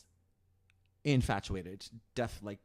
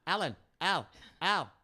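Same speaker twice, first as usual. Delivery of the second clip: high, loud repeated calls mimicking a prairie dog; comic high-pitched animal call; prairie dog imitation; 7 semitones higher, 6 dB louder than usual. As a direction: yelp the name like a little animal. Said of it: "the, the little prairie dog".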